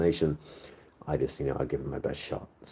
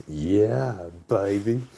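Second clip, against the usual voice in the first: higher, drawn-out swinging catchphrase; groovy spy impression, pitched up; Austin Powers; 5 semitones higher, much slower than usual. Streamer quoting a film spy's catchphrase, hammed-up impression. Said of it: "Frickin' Austin Powers".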